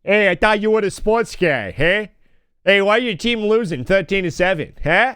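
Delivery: put-on pushy Masshole parent accent; mock Massachusetts sports-dad accent. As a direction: heckle the coach in broad Boston-area speech. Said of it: "surrounded by, uh, massholes".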